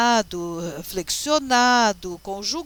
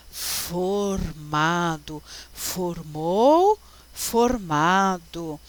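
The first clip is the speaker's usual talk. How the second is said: very slow, stretched syllables; careful word comparison; 4 semitones lower, much slower than usual. Shown as a demonstration. Teacher compares verb forms for students, slowly drawing out each word. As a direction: draw out each verb form separately.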